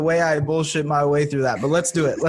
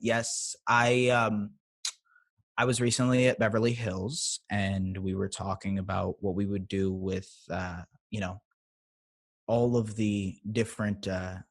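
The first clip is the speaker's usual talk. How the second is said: soft, low, posh Beverly Hills voice; bougie California accent; 4 semitones lower, 7 dB quieter than usual. Shown as a demonstration. Playing a Beverly Hills rich person, soft and low. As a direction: murmur like a rich Hills socialite. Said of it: "I'll do, like, a, a bougie accent".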